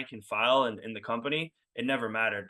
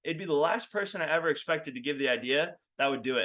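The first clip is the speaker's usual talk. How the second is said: ordinary delivery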